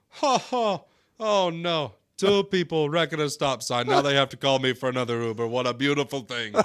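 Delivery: Uber driver's gleeful quote. Retold or imitated. Driver gloating over a wreck at the stop sign, laughing. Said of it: "he's like".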